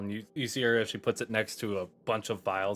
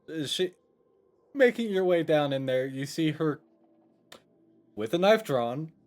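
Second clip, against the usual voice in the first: ordinary talk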